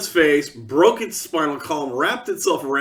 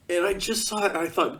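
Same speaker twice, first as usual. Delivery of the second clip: ordinary talk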